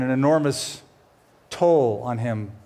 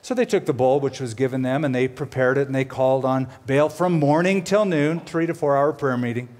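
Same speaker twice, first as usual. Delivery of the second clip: ordinary talk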